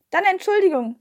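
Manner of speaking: quick apology. A narrator's reading. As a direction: blurt out an apology before leaving. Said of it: "sagte ich schnell".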